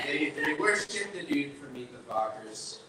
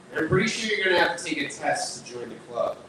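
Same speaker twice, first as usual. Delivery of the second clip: usual speaking voice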